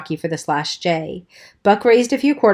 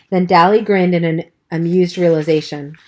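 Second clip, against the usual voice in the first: ordinary delivery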